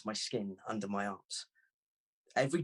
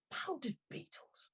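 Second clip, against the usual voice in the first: disbelief; 9 dB quieter than usual. Acted. Narrator mutters in disbelief.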